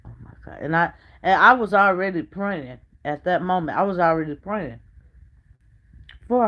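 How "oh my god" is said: breathy hushed oh my God; whispered shock. Retold or imitated. Reacting to hearing her husband left, whispered shock. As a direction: whisper it as if the news hurts.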